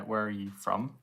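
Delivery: ordinary talk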